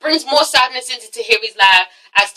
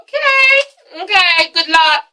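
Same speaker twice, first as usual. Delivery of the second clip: mock weepy; 6 semitones higher, slower than usual. Mocking a weepy reality star.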